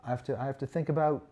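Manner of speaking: a mock British accent that keeps drifting Scottish and Australian; a streamer trying on a British accent for a redeemed chat request; attempted British accent. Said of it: "You started very Scottish".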